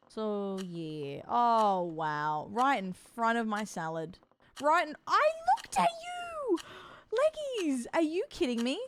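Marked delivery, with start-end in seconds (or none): 4.6-8.8 high, loud shocked yelp at the spider; startled outburst. Real, not acted. Streamer startled by a spider on her table, shrieking high and loud. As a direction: shriek at it for crawling by the food. Shouting at spider near food.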